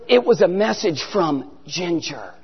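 low, soft, slow reveal; hushed reveal. Retold or imitated. Bill revealing Ginger's message, low and slow. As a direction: drop it quietly and slowly. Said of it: "He goes".

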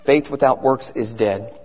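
very low, softened preaching; quiet solemn reminder. Real, not acted. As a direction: drop the voice to let the verse sink in. Key verse repeated low and quiet.

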